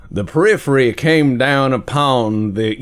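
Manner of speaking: lightly drawled Southern professor line; Southern accent. Shown as a demonstration. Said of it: "This is not my native accent".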